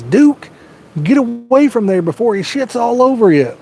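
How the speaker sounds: high, sharp mock father warning; stern dad punchline. Father warning dog away from the farting boyfriend, high sharp call. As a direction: shoo the dog off like an exasperated dad.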